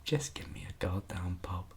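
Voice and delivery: breathless whisper, pleading; whispered plea. Real, not acted. Host ending his rant, whispering a plea for a pub. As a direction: beg for a pub under your breath.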